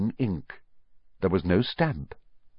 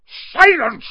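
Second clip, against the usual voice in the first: very high, loud yell; 9 dB louder than usual. A narrator's reading. Uncle silences boy, yelling. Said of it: "yelled Uncle Vernon".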